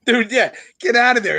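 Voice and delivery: normal talking voice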